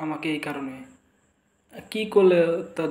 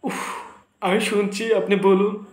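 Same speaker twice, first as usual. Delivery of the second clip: exasperated outburst; 5 semitones higher, 5 dB louder than usual. Acted. Exasperated principal snaps back.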